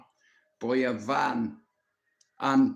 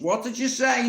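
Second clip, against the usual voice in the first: higher, louder suspicious granny; granny demanding; 9 semitones higher, 5 dB louder than usual. Retold or imitated. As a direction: catch him out like a sharp granny. Granny overhears swearing.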